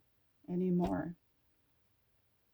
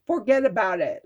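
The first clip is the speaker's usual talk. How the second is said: put-on Italian accent; 7 dB louder than usual. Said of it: "in an Italian voice".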